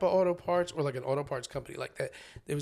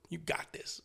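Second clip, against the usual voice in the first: whispered reassurance; 9 dB quieter than usual. Retold or imitated. Quiet reassurance to himself.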